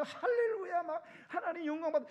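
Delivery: high, elated worshipper's cry; acted grateful praise. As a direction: exclaim praise as if overflowing with thanks. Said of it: "이런 마음으로 예배를 드리는지". (감사에 벅찬 예배자 흉내)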